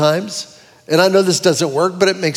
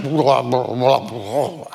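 lower, fading mock mumble; mumbled noise; 8 semitones lower, 4 dB quieter, much slower than usual. Crowd hearing God's voice as noise, lower mock mumble. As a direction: make the words sound like meaningless noise. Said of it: "To those that are around him, it was like".

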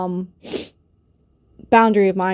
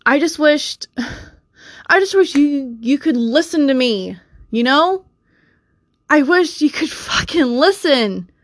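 high-pitched, exasperated demand to be heard; real frustrated pleading with an aggressive edge; 5 semitones higher than usual. Real, not acted. Venting at Mister J about wishing they would listen, aggressive. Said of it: "I'm sorry if I sound really aggressive".

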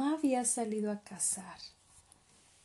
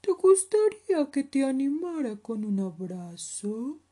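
bear character; 5 semitones higher, 9 dB louder than usual. Acted. Oso offers a hug.

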